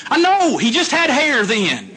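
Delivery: high amused wife with a laugh, imitated; the wife jokes back, laughing; wife's voice.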